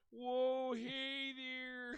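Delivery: put-on drunken high holler with drawn-out vowels; Pickles. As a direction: whoop it loudly like a drunk cartoon character. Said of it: "it's Pickles drunk at".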